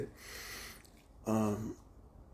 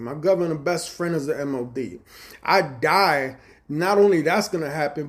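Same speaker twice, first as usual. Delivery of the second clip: louder, heated retold boast; retold threat; 5 semitones higher, 6 dB louder than usual. Telling his friend about cartel family, heated loud threat. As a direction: puff up and threaten hard.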